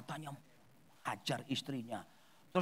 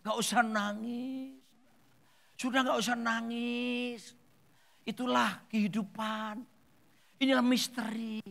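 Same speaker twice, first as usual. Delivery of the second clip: slow, higher-pitched drawn-out consolation; consoling friends; 6 semitones higher, slower than usual. Retold or imitated. Friends telling the widow not to cry, slow mock consoling. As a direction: imitate well-meaning comforters, stretching each phrase. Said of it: "Teman-temannya pada datang semua bilang gini".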